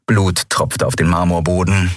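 ordinary delivery

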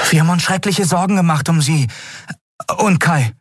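put-on very high, bright boyish voice, delighted; eager boy Miko. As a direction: pitch way up like an excited young lad. Miko worried, quick. (sehr hohe, freudige Jungenstimme)

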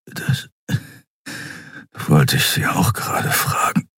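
breathless half-whisper, pained; weak wounded reply. A narrator's reading. Wounded admiral answering Shia, a weak half-whisper. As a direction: rasp it out barely voiced.

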